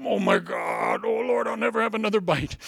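a lightly voiced overstuffed eater crying out; hammed-up regret after overeating; mock groan after a binge. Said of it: "you always go".

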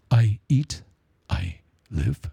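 slow, low, serene master voice; calm Caine. Retold or imitated. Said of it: "Remember Caine in Kung Fu? How cool he was, how calm he was".

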